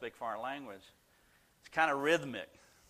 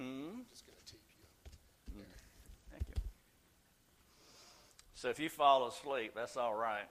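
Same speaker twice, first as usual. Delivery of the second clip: usual speaking voice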